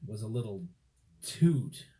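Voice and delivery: plain reading voice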